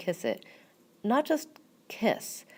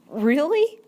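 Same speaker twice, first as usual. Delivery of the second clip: high, loud, rising astonishment; wide-eyed surprise; 10 semitones higher, 8 dB louder than usual. A narrator's reading. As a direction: ask it open-mouthed, amazed. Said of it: "eyes wide and mouth open".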